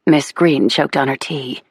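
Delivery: ordinary delivery, nothing marked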